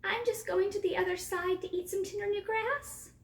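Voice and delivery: high-pitched small goat voice; frightened little goat. A narrator's reading. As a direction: explain timidly in a high goat voice. Frightened littlest goat answers troll.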